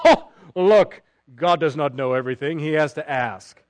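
lightly hammed-up smug discovery; mock the critic's triumphant tone; mocking know-it-all. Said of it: "some people in their intellectual greatness".

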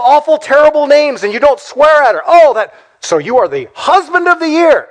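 high-pitched, mock-amazed congratulations; sarcastic praise. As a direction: gush with exaggerated admiration, dripping with sarcasm. Sarcastic praise for minimal decency.

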